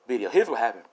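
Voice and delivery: ordinary delivery, nothing marked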